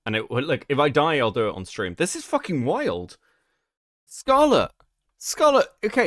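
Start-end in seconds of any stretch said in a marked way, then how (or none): 4.1-6.1 calling out. Real, not acted. Calling out to the video's maker.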